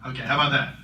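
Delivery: projecting to the back row. Shown as a demonstration. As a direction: speak up so the back hears.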